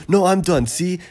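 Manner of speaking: eager, insistent claim, lightly acted; mock insistence. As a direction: imitate someone contesting a race result. Acted arguing over who finished first.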